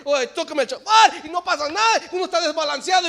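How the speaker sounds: loud, high sermon cry; fiery preaching. Acted. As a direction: preach with full force, building. Preaching at full force.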